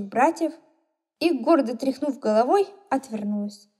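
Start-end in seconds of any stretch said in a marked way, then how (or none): none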